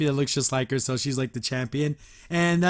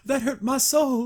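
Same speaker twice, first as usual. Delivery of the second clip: higher and slow, playing wounded; mock hurt; 8 semitones higher than usual. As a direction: drag out soul like a heartbroken sidekick. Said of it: "And then the guy's like".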